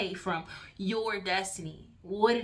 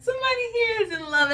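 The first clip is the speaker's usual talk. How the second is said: laughing; 10 semitones higher, 6 dB louder than usual. Real, not acted. Laughs at card, somebody in love.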